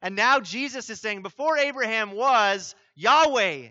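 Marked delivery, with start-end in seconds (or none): 1.2-3.7 "Yahweh" proclaimed loud and high; stress on YAHWEH. Shown as a demonstration. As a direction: build to a ringing, emphatic "Yahweh". Substitutes God's name with force.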